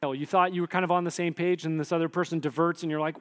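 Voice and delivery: ordinary talk, nothing marked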